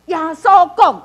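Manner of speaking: 耶稣讲 punched high and loud; emphatic. Shown as a demonstration. Teacher showing which words matter in prayer, emphatic 耶稣讲. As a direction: hit 耶稣讲 hard as important words. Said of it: "你若感觉讲即句话真重要".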